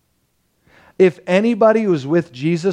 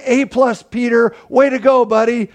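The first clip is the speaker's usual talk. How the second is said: bright, higher and louder pat on the back; gushing mock praise; cheery praise; 6 semitones higher, 3 dB louder than usual. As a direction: cheer him on like a proud coach. Jesus praising Peter, voiced.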